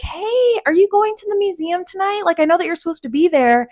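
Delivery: high bright fake casual question; high rescuer voice. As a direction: play the rescuing couple's fake innocent excuse. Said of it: "And they're like".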